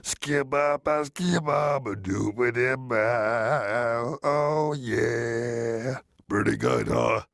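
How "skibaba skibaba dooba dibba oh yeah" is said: slow, scatting like [name]; [name] scat. Acted. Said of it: "here's my impression of [name]".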